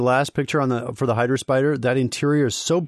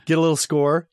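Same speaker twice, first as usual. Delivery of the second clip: normal talking voice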